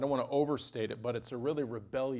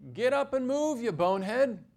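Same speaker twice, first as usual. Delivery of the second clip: high, loud scolding yell at a stubborn prophet; shouted rebuke, much higher and louder; exasperated shout; 11 semitones higher, 6 dB louder than usual. Acted. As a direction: bark it at him, fed up. Preacher scolds Jonah, exasperated.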